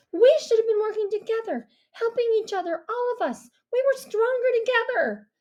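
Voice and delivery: Mia's reproachful voice; girl's voice. A narrator's reading. Mia voiced by narrator.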